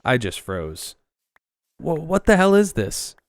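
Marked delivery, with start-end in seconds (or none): 1.8-3.1 high, loud, frantic shriek; panicked disbelief. Acted. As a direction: lose it in sheer panic. Terrified narrator at absurd request.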